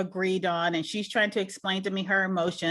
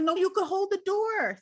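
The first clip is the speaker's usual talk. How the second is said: urgent plea; 10 semitones higher than usual. Retold or imitated. Urging daughter to hold door.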